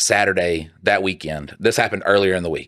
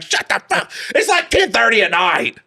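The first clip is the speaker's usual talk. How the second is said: high mock yell, laughing; mock an annoyed wife; wife yelling late at night; 12 semitones higher than usual. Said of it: "Just like".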